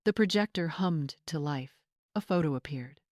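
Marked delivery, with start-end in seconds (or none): none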